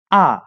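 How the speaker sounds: Ah with a sharp falling fourth tone. Shown as a demonstration. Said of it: "Let's hear the difference between male and female".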